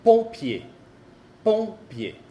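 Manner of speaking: pompier with a nasal first vowel, no m; French pompier, nasal on; French demo word. Shown as a demonstration. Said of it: "Otro ejemplo".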